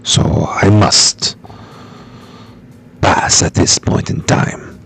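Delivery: put-on low, dramatic telenovela Mexican accent, drifting French; hammed-up, low Mexican accent. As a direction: play a low-voiced Latin soap star with a bad accent. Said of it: "trying to do my telenovela".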